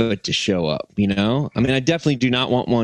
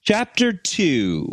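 slow, higher-pitched title read; chapter announcement; 5 semitones higher, slower than usual. Pretend. Co-host announcing a new chapter on request, slow announcement. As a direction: announce the chapter grandly, drawing it out.

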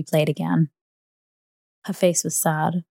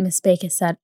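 plain reading voice

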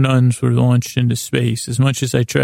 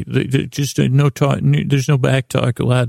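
normal talking voice

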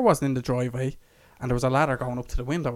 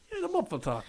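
high mock voice; 8 dB quieter than usual. Mocking "muffled talk.

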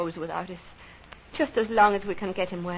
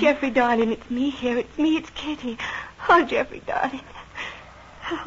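higher, tender but excited; wife greeting her man as he wakes, higher with joy; tearful joy; 4 semitones higher than usual. Acted. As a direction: welcome him back with joy.

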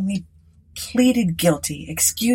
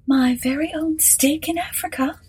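much higher gracious hostess; Mrs Sinclair; 10 semitones higher than usual. Acted. Hostess inviting Aylett inside, high and gracious. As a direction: invite him in grandly.

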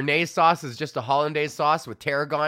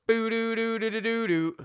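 high hummed guitar line; scat humming a riff; 8 semitones higher than usual. Acted. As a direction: scat the melody.

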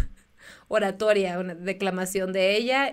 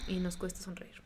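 whispered; 2 semitones lower, 12 dB quieter than usual. Pretend. Jokes about being depressive.

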